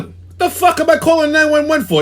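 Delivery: can't believe what he's hearing; first take, angry but held back; incredulous outburst. Retold or imitated. Retold fight with phone rep.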